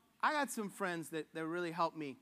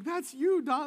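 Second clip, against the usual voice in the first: higher, louder teasing exclamation; playful jab; 5 semitones higher, 7 dB louder, slower than usual. Retold or imitated. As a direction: poke fun at your friend. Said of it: "I'm like".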